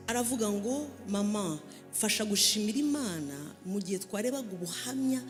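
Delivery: usual speaking voice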